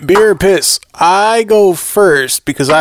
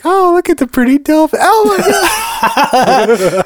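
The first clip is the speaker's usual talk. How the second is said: mock gushing, much higher pitch; gushing dolphin fan; 11 semitones higher than usual. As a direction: coo over a cute dolphin in a high voice. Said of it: "people are afraid of sharks and like".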